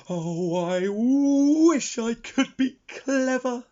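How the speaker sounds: high, slow, longing wish; wistful sigh. A narrator's reading. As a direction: sigh it out, wishing hard. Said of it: "he sighed".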